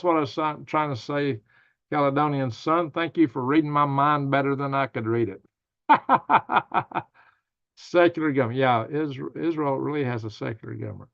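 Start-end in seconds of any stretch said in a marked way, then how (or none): 5.9-7.0 sharp high laughter. Real, not acted. Laughing at a viewer reading his mind, high laugh.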